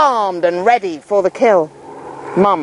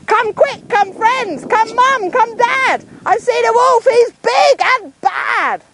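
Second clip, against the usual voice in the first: much higher, ringing call down the hill; slower than usual. Pretend. Nick calling the town from the hilltop, urgent. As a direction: call out for help across the valley. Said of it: "stand up here alone and call".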